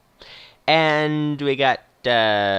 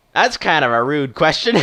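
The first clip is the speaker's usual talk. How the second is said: put-on louder Western old-timer; louder, playful Western accent; 3 semitones higher, 5 dB louder than usual. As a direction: play it as a Western movie old man, loud. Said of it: "doing a sort of Western accent".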